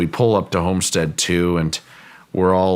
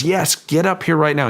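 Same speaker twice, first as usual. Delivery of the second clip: shouting; 9 semitones higher than usual. Retold or imitated. Retold shout from mesa top.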